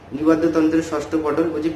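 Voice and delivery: ordinary delivery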